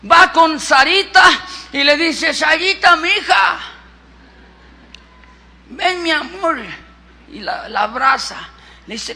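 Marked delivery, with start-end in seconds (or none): 2.3-6.6 high, playful husband calling his wife; esposo cariñoso. Acted. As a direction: coax her over with sweet exaggeration. Abraham llama cariñoso a Sarita. (marido meloso, voz aguda y juguetona)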